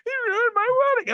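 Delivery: high, loud wail of a crying bride; mock crying complaint. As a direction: imitate an upset bride accusing. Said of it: "people crying".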